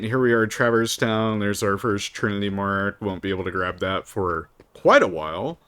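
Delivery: normal talking voice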